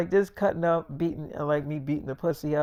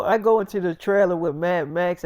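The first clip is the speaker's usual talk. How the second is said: normal talking voice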